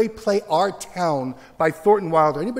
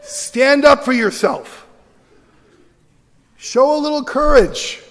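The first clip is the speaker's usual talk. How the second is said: loud, raised, emphatic rallying call; rousing exhortation; 7 semitones higher, 6 dB louder than usual. Real, not acted. Teacher urging teachers to show courage, loud rallying cry.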